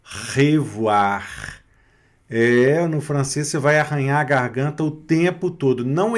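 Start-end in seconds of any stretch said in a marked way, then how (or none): 0.0-1.6 slow "Revoir" with R scraped in the throat. Shown as a demonstration. Teacher drills the French R, "Revoir" slow and guttural. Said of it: "O R sempre na garganta".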